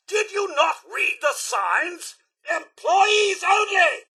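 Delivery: high-pitched, scolding outburst; indignant shout. Acted. Guard to someone past the staff-only signs, indignant shout.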